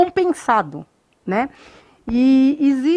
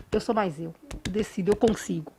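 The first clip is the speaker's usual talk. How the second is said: self-affirmation mantra; 5 semitones lower, 9 dB quieter than usual. Shown as a demonstration. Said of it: "você bate no peito".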